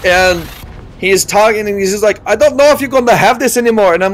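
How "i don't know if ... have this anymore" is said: imitating a doctor's thick accent, higher and quick; quick mock Middle Eastern doctor accent; thick foreign accent. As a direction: put on a hard-to-follow Middle Eastern accent. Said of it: "he's talking in this accent that I can barely understand".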